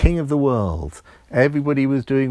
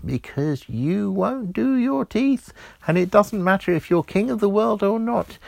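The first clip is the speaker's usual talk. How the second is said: little elf warning; 7 semitones higher than usual. A narrator's reading. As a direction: warn him like a fussy little elf. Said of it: "The little elf said".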